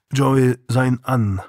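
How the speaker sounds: plain reading voice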